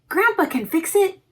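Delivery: put-on high, eager child's voice; little Joseph. Boy telling his mother grandpa will help, high and eager. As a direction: pipe it up like a hopeful boy. Child voice in read-aloud story.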